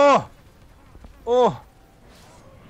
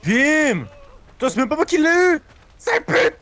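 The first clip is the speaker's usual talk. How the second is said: high, loud, fast furious shout; 9 semitones higher, 7 dB louder than usual. Real, not acted. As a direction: shout in fury. Kill stolen, furious.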